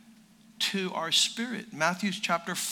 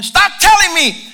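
much louder, higher outburst; exasperated shout; 10 semitones higher, 15 dB louder than usual. Retold or imitated. Someone tired of hearing about the Lord, exasperated shout. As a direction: cut in with a loud, fed-up yell. Exasperated listener cuts the preacher off.

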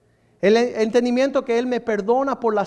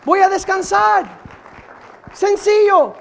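higher and much louder, proclaimed; louder proclamation; 7 semitones higher, 8 dB louder than usual. Shown as a demonstration. Preacher repeating his resolve to the church, louder and higher. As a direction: lift it into a cry of resolve. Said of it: "repeated louder".

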